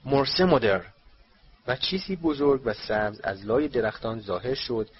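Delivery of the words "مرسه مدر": louder, forceful incantation; spell incantation. A narrator's reading. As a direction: cast the spell out loud. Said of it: "بلکه یک ورد بود". (ورد خواندن با صدای محکم)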